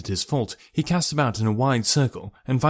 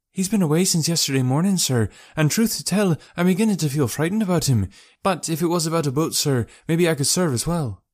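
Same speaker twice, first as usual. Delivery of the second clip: high-pitched working woman voice; boatman's wife, worried; 6 semitones higher than usual. Acted. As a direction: confide unease in a higher voice.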